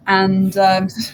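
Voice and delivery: normal talking voice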